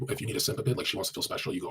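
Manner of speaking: normal talking voice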